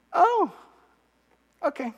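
lightly voiced wife, high little 'oh'; high, mild, underwhelmed response; unimpressed wife. Wife answers husband's dramatic news, mildly unimpressed. Wife's anticlimactic reply, voiced.

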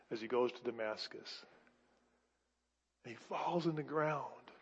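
ordinary delivery

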